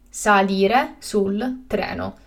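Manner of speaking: slow and carefully spaced; slow, clear repetition. Shown as a demonstration. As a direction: say it again slowly for learners. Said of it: "teacher repeats the word twice".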